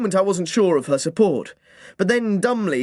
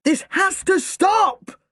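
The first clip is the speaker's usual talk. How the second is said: put-on boy bursting out at his mother; yelled; slower than usual. A narrator's reading. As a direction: shout it to stop everyone. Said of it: "I yelled".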